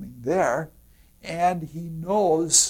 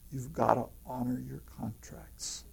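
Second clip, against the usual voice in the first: whispered advice, no voice; 4 semitones lower, 13 dB quieter than usual. Retold or imitated. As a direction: breathe it like a sly secret. Lodge's line retold as whisper.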